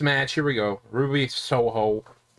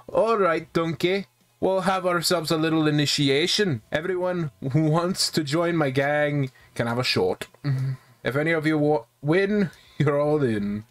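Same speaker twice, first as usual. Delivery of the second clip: Shrek; 3 semitones higher than usual. Acted. Gamer as gang leader announcing an initiation, Shrek voice. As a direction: put on a cartoon ogre's lilt. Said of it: "I'm not trying to do a Shrek voice".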